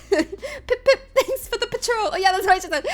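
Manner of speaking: giggling mock British toff; terrible British accent. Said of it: "me doing a terrible accent".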